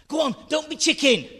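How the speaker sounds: high, loud, fast dare; urgent whisper. Acted. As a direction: egg on a scared friend. Kids daring each other.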